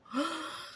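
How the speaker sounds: high, breathy fake gasp; a hammed-up shocked intake of breath; mock gasp. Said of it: "Glinda does is go".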